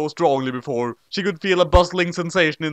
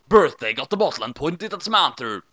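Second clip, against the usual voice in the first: usual speaking voice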